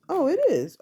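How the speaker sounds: high, loud happy surprise; delighted discovery; surprised. Real, not acted. Finds the right kit.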